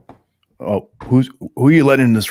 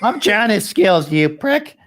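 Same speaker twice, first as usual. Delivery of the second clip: put-on old lady voice; 11 semitones higher, much slower than usual. Plays the mom character.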